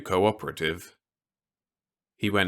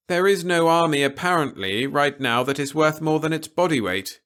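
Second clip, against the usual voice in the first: a lightly raised, measured officer's voice; stern officer; 8 semitones higher, 5 dB louder than usual. A narrator's reading. Officer warning Peter against anything rash, lightly voiced and grave.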